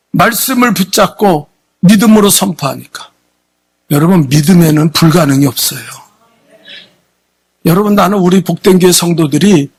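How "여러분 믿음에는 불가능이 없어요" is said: normal talking voice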